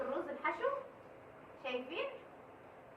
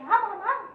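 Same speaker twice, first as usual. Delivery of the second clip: playful gobbling noise; 7 semitones higher, 11 dB louder than usual. Acted. Imitated gobbling.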